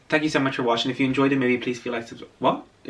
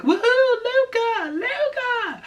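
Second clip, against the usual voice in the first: very high, drawn-out cheer across a distance; cheering him on; 5 dB louder, much slower than usual. Retold or imitated. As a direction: call out his name like waving off a train. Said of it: "he cheers on Luca".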